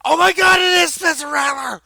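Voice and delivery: high, screamed fan excitement; loud, star-struck retold shriek; freaking out. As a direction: imitate a buddy losing it over a celebrity. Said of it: "we both freaked out".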